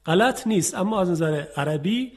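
ordinary talk, nothing marked

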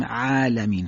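"عالمین" with a thin, fronted long "a" after ain; careful Arabic pronunciation; light ain. Shown as a demonstration. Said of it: "مستعلی هستن نیست".

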